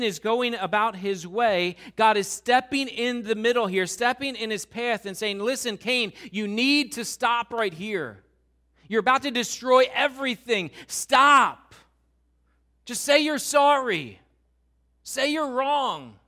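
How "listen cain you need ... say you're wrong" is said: rising to a loud, slowed plea; God pleading urgently. Acted. God blocking Cain's path, begging him to stop, building to a shout. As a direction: stop him in his tracks, louder each line.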